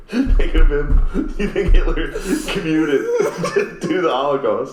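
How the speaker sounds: high-pitched, laughing through the words; can barely get it out for laughing. Real, not acted. Cracking up at own joke.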